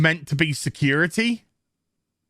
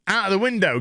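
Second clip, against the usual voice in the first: usual speaking voice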